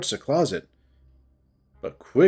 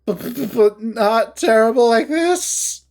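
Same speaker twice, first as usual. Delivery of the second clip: high, desperate and tearful; shaky, tearful; 8 semitones higher, 3 dB louder than usual. Acted. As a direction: fight back tears. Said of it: "she breathes in shakily".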